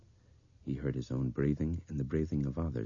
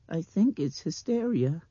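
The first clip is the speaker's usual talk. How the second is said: high, louder child's mimicry; mimicking little girl; 13 semitones higher, 5 dB louder than usual. A narrator's reading. Blind girl repeating Brian's whisper, high mimicry. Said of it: "she mimicked".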